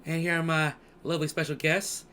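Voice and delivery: normal talking voice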